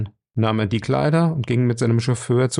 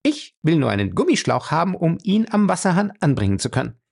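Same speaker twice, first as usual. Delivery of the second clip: high, insistent female voice; firm woman in a higher voice; determined captive woman; 7 semitones higher than usual. A narrator's reading. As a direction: spell out the request, pitched up.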